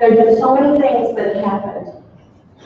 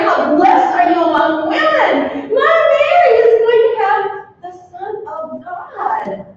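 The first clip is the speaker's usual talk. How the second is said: joyful cry; 8 semitones higher, 3 dB louder than usual. Acted. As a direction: proclaim it as a joyful shout. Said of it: "I cried".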